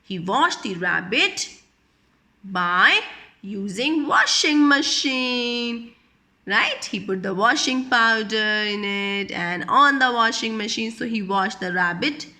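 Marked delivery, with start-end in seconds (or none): none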